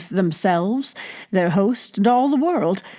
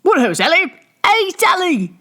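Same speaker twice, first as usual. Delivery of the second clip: much higher, calling out across a noisy room; rowdy tavern shouts; 10 semitones higher, 4 dB louder than usual. Acted. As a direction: patrons hollering for the barmaid. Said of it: "accompanied the shouts".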